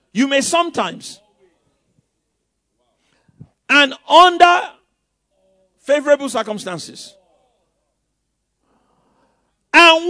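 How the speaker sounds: loud, slow, drawn-out pulpit proclamation; preaching at full force. Acted. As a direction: preach each phrase with a rising fire. Preacher reading scripture forcefully.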